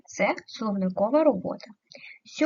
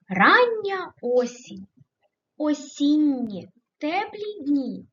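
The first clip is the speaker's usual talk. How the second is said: higher-pitched, expressive verse reading; poem recitation; 6 semitones higher, 3 dB louder, slower than usual. Acted. Teacher reading autumn verse to pupils, expressive recitation. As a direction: recite the verse in measured, lifted phrases.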